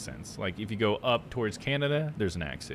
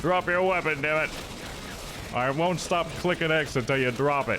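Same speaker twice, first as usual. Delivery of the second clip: raised, hammed-up demand; mock command; 6 semitones higher than usual. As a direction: playfully yell at a stubborn game enemy. Mock-yelling at a game enemy.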